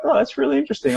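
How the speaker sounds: high, light voice of an amused moviegoer; mock amused wonder. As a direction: marvel at it like a show. Watching thoughts like a film.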